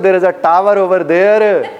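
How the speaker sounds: slow Pakistani English, every r sounded in "tower over there"; Pinglish accent. Shown as a demonstration. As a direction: spell out each word in a Pinglish accent with rolled r's. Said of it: "अगर मैं पिंग्लिश में बोलूं". (पिंग्लिश लहजा, हर र साफ़ और धीरे)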